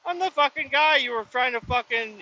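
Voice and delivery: high, indignant, half-yelled; exasperated retort. Retold or imitated. Retelling to viewers, high indignant retort at guard. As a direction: throw it back at her, outraged.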